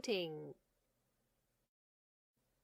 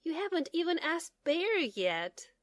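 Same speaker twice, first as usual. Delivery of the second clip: higher, louder amused mother; 8 semitones higher, 6 dB louder than usual. Acted. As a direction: gently tease the eager child. Mother voiced in story.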